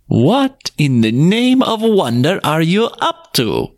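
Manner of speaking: a passerby stops short, amazed; exclaiming passerby. A narrator's reading. Passerby calling out to the old couple, slow and astonished. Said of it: "exclaimed a passerby".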